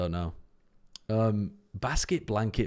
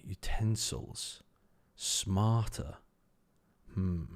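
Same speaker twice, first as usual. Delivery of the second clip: ordinary delivery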